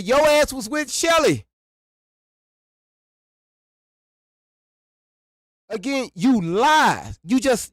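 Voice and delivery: high, loud, angry shouting at a liar; furious accusation. Real, not acted. Calling out a liar on stream, furious and loud. Confronting someone who lied.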